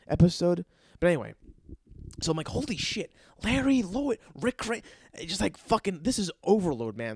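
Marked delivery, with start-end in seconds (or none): 2.5-4.8 starstruck. Retold or imitated. Starstruck over celebrity names.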